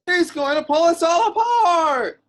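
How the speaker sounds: loud fake-panic yell; panicked shout. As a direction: sell the terror loudly to the guards. Wookiee breaks loose, alarm.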